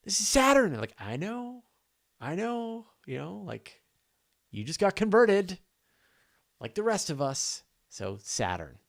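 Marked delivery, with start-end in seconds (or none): 0.0-7.6 excited. Retold or imitated. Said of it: "And I'm like".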